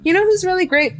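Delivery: high, mock-gushing question; mocking quote. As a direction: parody their fake enthusiasm. Mocking the gallery people's praise.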